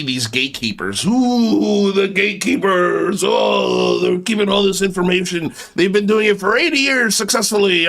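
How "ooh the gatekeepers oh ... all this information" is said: mock spooky. Mocking spooky gatekeepers.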